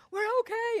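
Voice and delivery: son crying. Retold or imitated. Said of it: "And he's crying".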